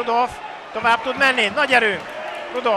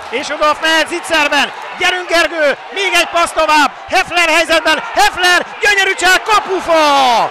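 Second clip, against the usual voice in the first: excited hungarian sports commentary; 6 semitones higher, 5 dB louder than usual. Real, not acted. Commentator calls a chance near goal.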